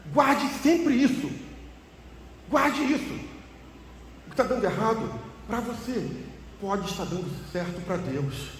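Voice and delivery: high, loud, emphatic sermon voice; fervent preaching. Real, not acted. Preacher urging the congregation to remember, fervent and loud. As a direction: press the lesson home with fire.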